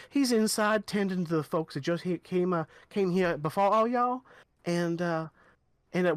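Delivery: a hint of a chatty official; lightly voiced quest giver. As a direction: talkative noblewoman, lightly voiced.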